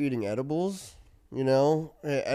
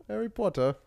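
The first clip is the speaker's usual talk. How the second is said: slightly higher-pitched British imitation; British accent demo; 8 semitones higher than usual. Shown as a demonstration. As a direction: toss off an easy mock-British accent. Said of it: "British accents are easy".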